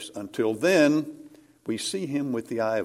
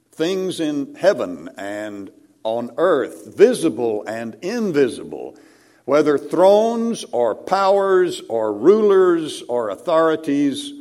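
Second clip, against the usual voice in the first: scripture reading; 3 semitones higher, 5 dB louder than usual. Acted. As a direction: proclaim Paul's words louder, building to the thrones and powers. Reads Paul's letter with force.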